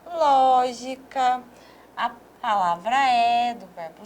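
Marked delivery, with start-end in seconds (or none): 0.1-1.4 lógica said slowly, the first syllable ló stressed; slow stress demo. Shown as a demonstration. Teacher pointing out the accented syllable, slow and deliberate. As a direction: lean on the ló of lógica. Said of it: "olha só".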